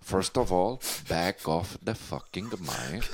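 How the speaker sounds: mock menace that fades into a whisper; menacing hush. Threatens guest over the mic.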